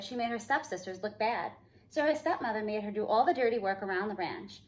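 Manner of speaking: usual speaking voice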